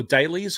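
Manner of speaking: Dailies said "DAY-leez", long A; long-A pronunciation. Shown as a demonstration. Streamer offering the other option, Dailies as "DAY-leez". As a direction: say Dailies like the word "daily". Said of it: "which way you'd pronounce it".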